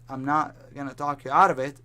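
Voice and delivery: usual speaking voice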